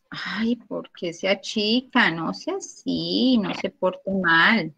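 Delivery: soft, slow, playful scolding of the device; mock scolding. Real, not acted. Teacher scolds a misbehaving screen. (regaño suave y juguetón, despacio)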